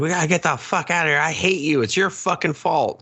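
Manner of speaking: high-pitched imitation of an angry outburst; mock frantic anger. Retelling one woman turning on the other, mock frantic anger. Acted quarrel from the film.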